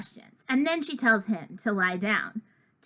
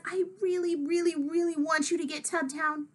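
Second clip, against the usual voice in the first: put-on pleading kid; 7 semitones higher than usual. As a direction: beg for it sweetly, pitched up. George pleading after crying.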